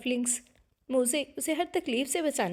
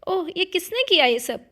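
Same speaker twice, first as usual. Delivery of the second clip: higher, louder startled cry of a soaked sleeper; jolted awake by cold water; 7 semitones higher, 7 dB louder than usual. A narrator's reading. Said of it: "हड़बड़ा कर उठी थी". (हड़बड़ाकर चौंकते हुए)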